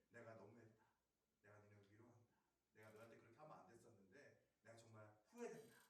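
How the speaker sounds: soft remorseful apology. Acted. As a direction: confess your regret quietly, head bowed. Paul's remorseful letter voiced.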